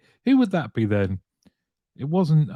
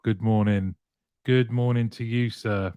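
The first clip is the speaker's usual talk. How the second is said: ordinary delivery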